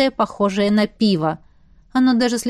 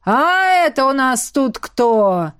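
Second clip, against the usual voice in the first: higher, slow, booming drawl; 4 semitones higher, 3 dB louder, slower than usual. A narrator's reading. Baron hails them from the side, booming. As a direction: call out grandly, stretching the vowels. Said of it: "Вдруг раздалось громогласное".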